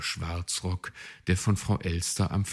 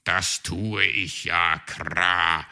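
slow, dragging, not convinced; unconvinced; 6 dB louder, slower than usual. A narrator's reading. Raven humours the magpie, unconvinced. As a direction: say it without believing it. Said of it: "entgegnete er wenig überzeugt".

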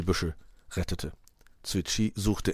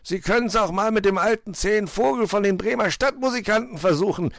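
high, loud mocking fox; pitched-up jesting fox voice; amused fox; 13 semitones higher, 5 dB louder than usual. A narrator's reading. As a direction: tease the cook gleefully. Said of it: "lachte Herr Fuchs".

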